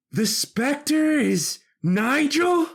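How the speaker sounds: high, slow, stunned question; gasping realization; shocked disbelief. Acted. Shocked discovery.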